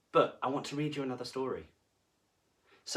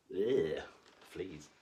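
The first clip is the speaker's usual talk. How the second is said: disgust; 6 semitones lower than usual. A narrator's reading. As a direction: say it with a pulled face. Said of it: "disgusted aside".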